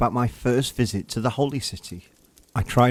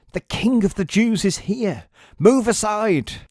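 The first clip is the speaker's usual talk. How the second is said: high, loud call cutting through the market; excited crowd shout; 10 semitones higher, 5 dB louder than usual. Acted. A pilgrim shouting to the crowd to move aside. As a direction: clear the road, he's coming. Crowd gossip announcing the king.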